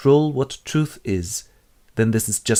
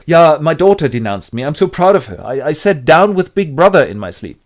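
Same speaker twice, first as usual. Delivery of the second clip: higher, louder eager voice; proud Parsons; 5 semitones higher, 6 dB louder than usual. Acted. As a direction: beam with pride. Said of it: "Parsons voiced".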